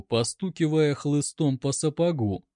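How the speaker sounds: ordinary delivery, nothing marked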